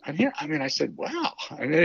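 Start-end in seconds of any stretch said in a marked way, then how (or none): 1.0-1.3 a high, drawn-out wow in a much higher voice; retold amazement. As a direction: relive being floored by the offer. Retold amazement at 30 days credit.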